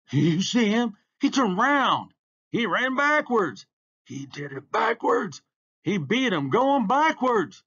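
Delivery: high, loud crowd exclamations; amazed excitement. A narrator's reading. Crowd marvelling at the winner, excited shouting. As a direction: shout the astonishing news to everyone around.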